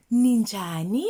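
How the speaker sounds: Ninjani called out loud with a rise; loud model. Shown as a demonstration. Teacher loudly modelling ninjani for kids. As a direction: call Ninjani out to the class. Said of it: "Can you say it?".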